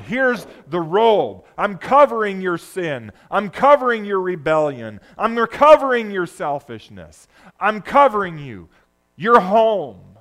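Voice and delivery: loud, ringing welcome home; impassioned father's welcome. Acted. Preacher voicing the father welcoming the son, loud and fervent. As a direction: proclaim it like a father embracing his lost son.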